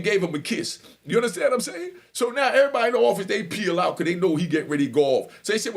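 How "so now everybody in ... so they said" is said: usual speaking voice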